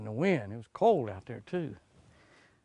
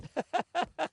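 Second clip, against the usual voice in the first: laugh; 13 semitones higher than usual. Real, not acted. Laughing at fish joke.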